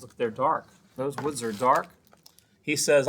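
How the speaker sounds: ordinary delivery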